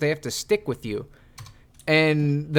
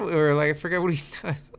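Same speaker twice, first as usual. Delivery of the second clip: ordinary delivery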